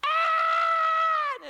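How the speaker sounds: long, high, loud scream; sustained full-voiced terrified yell; imitated scream. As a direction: scream as if thrown into fire. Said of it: "be going there going".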